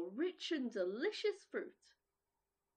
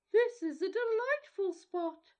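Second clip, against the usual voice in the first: higher, louder, blustery wind; north wind voice; 7 semitones higher, 8 dB louder than usual. Acted. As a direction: roar it like a wild wind. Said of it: "he said".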